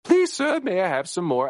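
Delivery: high-pitched Oliver Twist pleading in British; hammed-up British waif voice, starting very high; mock British accent. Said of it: "it's this kind of ... accent".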